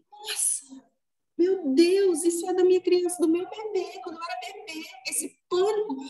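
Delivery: put-on high, breathless discovery of old pain; high-pitched stunned recognition; startled realization. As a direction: gasp it out as the truth hits. Sudden realization in retold moment.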